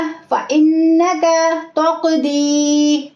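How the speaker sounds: slow recitation with held ghunna; recitation demo. Shown as a demonstration. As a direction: draw out फ़इन्नका with a nasal hold on नन्न. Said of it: "यहाँ तक किस किस को याद हो गया". (फ़इन्नका तक़दी धीमी तिलावत, गुन्ना के साथ)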